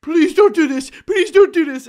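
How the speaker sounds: high, loud mock-tearful plea; hammed-up wailing beg; desperate begging. Man begs partner not to leave, hammed-up desperation.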